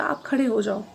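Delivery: ordinary delivery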